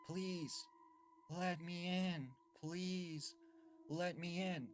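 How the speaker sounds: put-on high, drawn-out boy's plea; eerie child pleading. Strange boy at the front door asks to come inside, eerie pleading.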